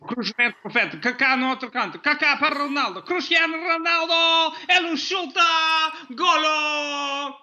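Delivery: excited commentator building to the goal; Portuguese play-by-play rising into a goal cry; Portuguese soccer commentary. Shown as a demonstration. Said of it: "the German one was".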